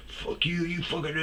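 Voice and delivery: mock unhinged rant. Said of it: "like, totally unhinged".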